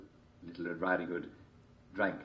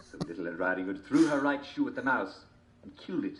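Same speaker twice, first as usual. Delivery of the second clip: normal talking voice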